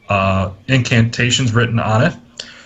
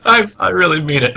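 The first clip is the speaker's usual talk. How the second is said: mock insistence; 8 semitones higher than usual. Mock offence, then says kidding.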